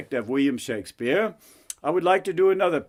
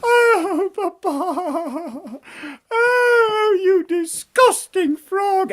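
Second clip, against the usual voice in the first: high, drawn-out bratty wail; wailing princess; much slower than usual. Acted. Princess protests to papa about frog, wailing princess voice. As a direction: wail in falsetto with theatrical disgust.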